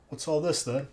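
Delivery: lightly done British accent, mocking a meme; a quick jokey British "What's all this then?". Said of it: "making fun of, like, the British accent".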